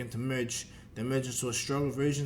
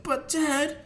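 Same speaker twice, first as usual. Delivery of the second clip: put-on higher, louder child's protest; protesting kid; 5 dB louder than usual. Goten objects to his dad, protesting. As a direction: plead with Dad in a high voice.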